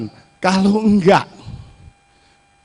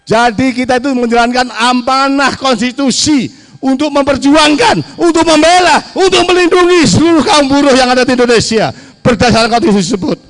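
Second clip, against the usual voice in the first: building rally shout; 5 semitones higher than usual. Real, not acted. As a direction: build to a passionate climax.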